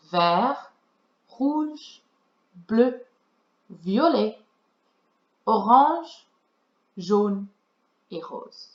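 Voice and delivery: each colour in French, one by one with pauses; French colour list. Shown as a demonstration. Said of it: "So to recap".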